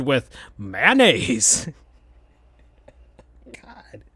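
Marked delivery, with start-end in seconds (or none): 3.5-4.0 whispered aside. Real, not acted. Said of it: "laughing aside".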